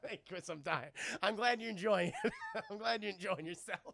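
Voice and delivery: talking through helpless laughter; real laughter breaking up the words; barely getting it out for laughing; cracking up. Real, not acted. Cracking up at chat.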